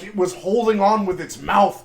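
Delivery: normal talking voice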